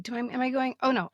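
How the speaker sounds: ordinary delivery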